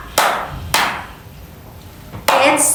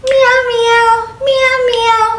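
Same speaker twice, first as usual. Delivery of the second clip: cat meow; 10 semitones higher than usual. Shown as a demonstration. Teacher imitates cat.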